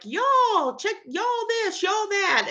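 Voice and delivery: high, hammed-up Southern y'all; mock Southern belle, high and bright with y'all; exaggerated Southern accent. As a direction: ham up your own Southern accent, calling out. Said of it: "talking the way I do".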